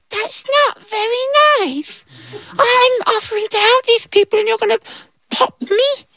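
very high squeaky hurt voice; wounded little ant; offended tiny ant. Acted. Ant protesting to the soldier, very high.

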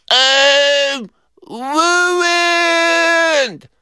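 very high, long-drawn wail. A narrator's reading. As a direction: wail it with lips barely moving. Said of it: "His lips barely moving".

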